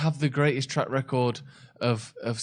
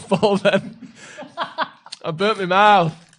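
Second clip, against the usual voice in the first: laughing; 7 semitones higher, 7 dB louder, slower than usual. Real, not acted. Laughing after burning mouth.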